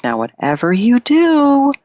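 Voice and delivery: lightly acted cautionary voice; emphatic warning. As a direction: warn her, rising high on the words. Beggar woman warns the queen.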